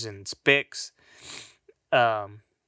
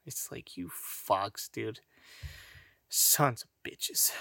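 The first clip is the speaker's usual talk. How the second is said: much softer, breathy muttered cursing; muttered curse; 3 semitones lower, 10 dB quieter, slower than usual. Real, not acted. Cursing Americans softly under his breath. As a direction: grumble it under your breath.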